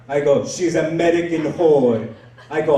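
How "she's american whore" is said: scornful put-on foreign accent; Abdul. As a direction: denounce her in a thick foreign accent. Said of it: "his name is Abdul".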